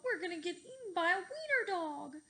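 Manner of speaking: high, loud terrified wail; panicked cry. A narrator's reading. Cheeseball fleeing the wiener dog, high loud wail. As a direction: wail as if about to be eaten. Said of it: "cried Cheeseball".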